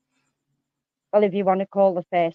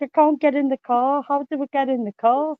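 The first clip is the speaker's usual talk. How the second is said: put-on high, loud, helpless drunk whine; imitating drunk Andy; 6 semitones higher, 5 dB louder than usual. Drunk friend stuck outside the car, high helpless whine. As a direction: whine it like a drunk who can't find the door. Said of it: "he was like".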